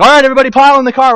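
loud, fast call to pile in; dad rounding up kids. Retold or imitated. Dad calling the kids out to the car, loud and fast. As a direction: bark the family into the car, quick and loud. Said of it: "Dad would be like".

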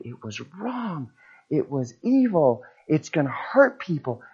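hint of a darkly serious colleague; hushed, low condemnation, lightly imitated; grave dark voice. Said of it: "his whole demeanor changed".

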